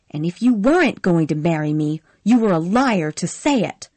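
loud, fast furious accusation. Acted. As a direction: fling it at him in rage. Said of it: "Scarlett said furiously".

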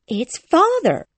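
put-on high, drawn-out girlish surprise; slow, high voice of a girl taken by surprise; Wendy surprised. A narrator's reading. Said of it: "said Wendy, surprised".

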